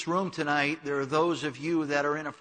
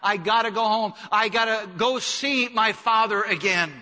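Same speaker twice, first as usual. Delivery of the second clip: loud, high resolve of the prodigal son; 6 semitones higher, 7 dB louder than usual. Acted. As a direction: cry out the son's decision to go home. Prodigal son voiced, resolving to return.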